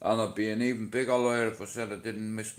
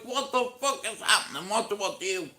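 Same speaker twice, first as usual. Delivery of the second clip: panicked; 8 semitones higher than usual. Retold or imitated. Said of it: "I shit myself".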